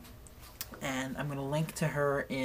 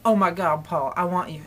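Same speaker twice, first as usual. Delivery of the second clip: louder, slightly lifted mock southern accent; attempted southern accent; 3 semitones higher, 7 dB louder than usual. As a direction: gush in a badly faked southern accent. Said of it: "trying to be a southern accent".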